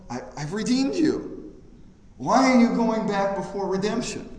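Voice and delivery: put-on a loving father urging his child, swelling then settling; God's pleading voice. Preacher voicing God to a groveling believer, high and loud. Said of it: "he's just going like".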